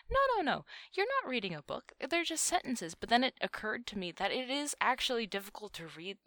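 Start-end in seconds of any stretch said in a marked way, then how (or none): 0.1-2.9 high, pushy no no no; emphatic protest. Retold or imitated. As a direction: insist it's just sentences. Retold argument over subs.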